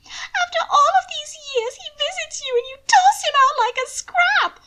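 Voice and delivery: high, loud tearful lady; high weepy accusation; tearful reproach. A narrator's reading. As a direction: wail it through tears. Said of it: "blinking away tears".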